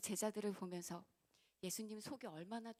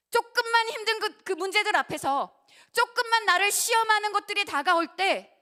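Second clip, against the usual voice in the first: much higher and much louder demanding sermon cry; fiery preacher calling out the listener's faith; challenging preaching shout; 11 semitones higher, 20 dB louder than usual. Acted. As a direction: throw the challenge straight at them. Preacher building to climax.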